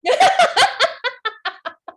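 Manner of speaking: a loud, high burst of laughter. Real, not acted. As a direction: laugh out at the joke. Reacting to troubleshoot suggestion.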